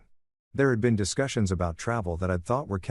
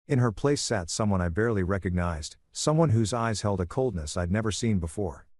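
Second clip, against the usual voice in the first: usual speaking voice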